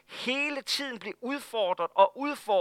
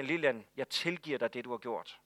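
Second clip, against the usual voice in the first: gentle forgiveness; 7 semitones lower, 7 dB quieter than usual. Retold or imitated. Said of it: "lægger han sin hånd på Lilian og siger".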